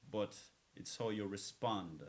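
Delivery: ordinary talk, nothing marked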